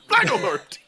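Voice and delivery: mock alarm. Joke alarm call.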